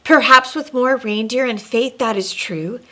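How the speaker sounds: lilting read-aloud storybook voice; storyteller reading rhyming verse; story narration. A narrator's reading. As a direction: read it like a bedtime rhyme to kids. Reads rhyming Christmas story aloud.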